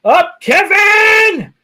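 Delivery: high, slow, shouted greeting; excited shout. Acted. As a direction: call his name out loud. Shouting a chatter's name.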